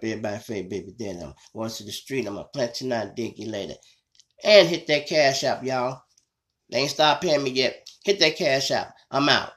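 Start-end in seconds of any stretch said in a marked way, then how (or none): 4.4-9.5 hype sign-off. Real, not acted. Loud sign-off plug.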